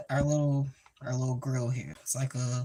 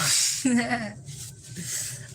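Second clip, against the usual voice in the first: high giggle; 9 semitones higher than usual. Real, not acted. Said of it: "I'm acting goofy".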